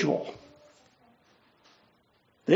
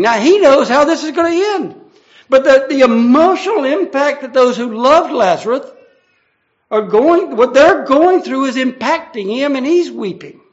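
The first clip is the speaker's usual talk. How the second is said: higher, louder sermon push; rising preaching; 6 semitones higher, 8 dB louder than usual. Acted. Preacher to congregation on Jesus' grief, higher and louder. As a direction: lift the voice as the point lands.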